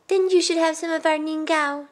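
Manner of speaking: put-on high child's voice offering help; generous young boy. Youngest boy offering cake to old woman, high and earnest. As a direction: pipe up kindly.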